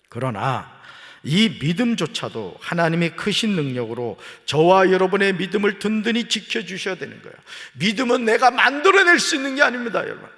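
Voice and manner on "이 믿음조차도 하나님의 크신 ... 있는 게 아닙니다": loud, high pulpit preaching; fervent preaching. Real, not acted. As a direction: drive it home to the congregation. Preaching at full force.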